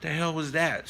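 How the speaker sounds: acted shock from the big tiger; startled tiger. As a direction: react in mock disbelief. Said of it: "And he's like".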